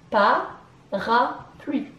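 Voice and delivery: "Pa, ra, pluie" in three separate beats; brief pause after each syllable of "Pa, ra, pluie". Shown as a demonstration. Teacher recounting three syllables, "Pa, ra, pluie" split apart. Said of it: "Trois syllabes".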